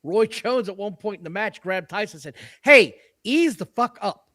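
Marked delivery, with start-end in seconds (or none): 2.6-4.3 loud, high, drawn-out mock warning; retold warning. Boxer grabbing his opponent mid-match, retold as a stern warning. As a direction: imitate an annoyed fighter, stretched out and raised. Retelling a fighter's in-ring warning.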